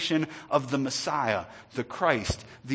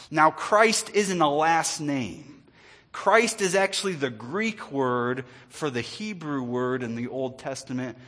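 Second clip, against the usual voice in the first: normal talking voice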